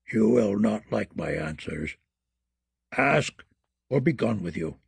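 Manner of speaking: put-on low, soft muttering old crone; terrible deep voice. A narrator's reading. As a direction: mutter like an ancient witch. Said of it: "the crone said".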